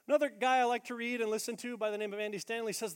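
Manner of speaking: ordinary talk, nothing marked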